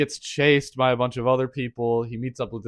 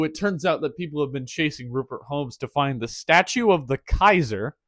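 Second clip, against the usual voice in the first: ordinary talk, nothing marked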